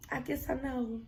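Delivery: soft, small sheepish admission; sheepish mumble. Retold or imitated. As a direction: barely say it, embarrassed. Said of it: "I said".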